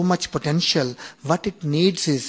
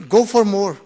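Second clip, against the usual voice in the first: self-urging shout; 5 semitones higher, 7 dB louder than usual. Retold or imitated. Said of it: "pushing himself through the pain".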